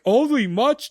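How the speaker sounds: high, indignant question; offended surprise. Retold or imitated. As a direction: snap back, insulted. Katya offended retort.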